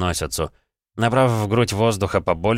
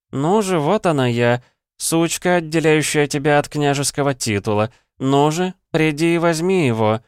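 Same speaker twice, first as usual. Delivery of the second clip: bait him, mockingly; provoking taunt; 8 semitones higher, 4 dB louder than usual. A narrator's reading. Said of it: "Кирана нарочито провоцировала его".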